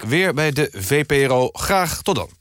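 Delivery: ordinary delivery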